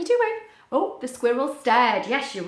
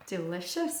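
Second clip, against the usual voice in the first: low, soft duck voice; lightly voiced duck; 5 semitones lower, 9 dB quieter than usual. Storybook duck agrees about soup, low soft voice. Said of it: "said the duck".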